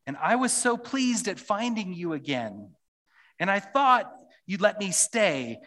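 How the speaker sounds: put-on high, loud, pleading child; upset Lucy. Preacher reading Lucy's complaint aloud as a high, loud, upset child. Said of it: "says Lucy".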